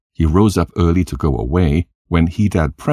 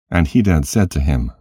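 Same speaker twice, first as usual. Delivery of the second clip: ordinary delivery, nothing marked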